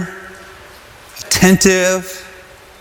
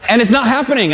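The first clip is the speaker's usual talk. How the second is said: eager prayer; 8 semitones higher, faster than usual. Retold or imitated. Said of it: "I'm like".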